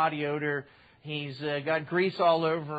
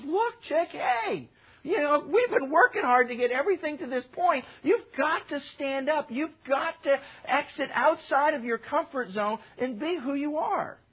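Mordecai's pep talk; 8 semitones higher than usual. Retold or imitated. Mordecai urging Esther to stand up, coaxing. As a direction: nudge her to stand up.

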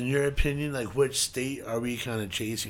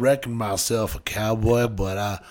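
cowboy drawl; 7 dB louder than usual. Pretend. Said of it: "It sounded like a cowboy saying it".

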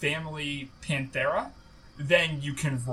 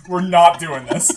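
louder, laughing insistence; louder laughing repeat; 11 dB louder than usual. Real, not acted. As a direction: say it again louder through a laugh.

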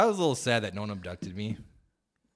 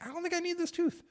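much higher, incredulous protest; high mock protest; 3 dB quieter than usual. Woman imitating herself refusing the dentist, high and incredulous. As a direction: squeak it back in disbelief.

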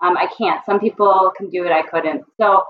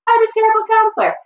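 high, quick sing-song answer; tossing off the stock reply in a high pitch; mock student answer; faster than usual. Grad students answering why they chose counseling, high quick mock voice.

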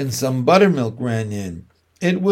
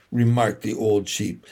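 ordinary delivery

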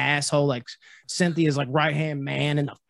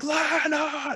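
a high shouted cry of the name; imitated scream; 11 semitones higher than usual. Retelling Joshua's scream at the jump kick, high shout. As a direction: yell it to rally the fighter. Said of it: "that's what Joshua screams".

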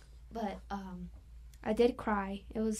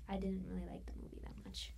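whisper; 7 dB quieter, faster than usual. Real, not acted. Confessing dislike under breath.

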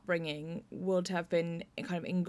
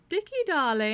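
higher, louder posh-lady trill on "Dickie, darling"; Hyacinth Bouquet; 9 semitones higher, 7 dB louder than usual. Retold or imitated. Playwright quoting Rosemary's opening line, in a trilling voice. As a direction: sing out "Dickie, darling" like a social climber. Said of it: "channeled a bit of Hyacinth Bouquet".

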